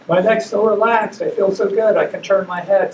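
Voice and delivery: high, loud gushing imitation; delighted retold patient. Patient marvelling to doctor at her relaxed neck, high excited imitation.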